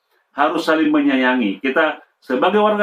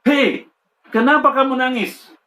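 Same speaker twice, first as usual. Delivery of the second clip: teacher's scolding question; 7 semitones higher than usual. Retold or imitated. Teacher scolds crying child.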